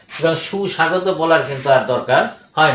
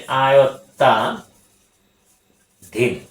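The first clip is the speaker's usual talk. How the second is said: slow deliberate; much slower than usual. Shown as a demonstration. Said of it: "আরেকটি জিনিস সর্বশেষ".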